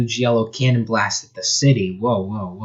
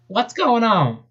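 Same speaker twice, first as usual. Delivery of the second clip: much higher panicked cry; Bunny yelling in panic, high; yelled; 11 semitones higher, slower than usual. Acted. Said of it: "yelled Bunny".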